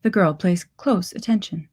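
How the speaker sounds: ordinary delivery, nothing marked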